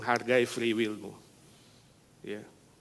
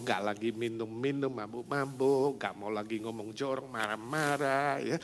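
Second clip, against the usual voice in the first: normal talking voice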